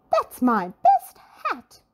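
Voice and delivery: a lady outraged at losing her hat; indignant cry. A narrator's reading. Little Miss Splendid protests about the camel, loud and indignant. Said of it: "cried Little Miss Splendid".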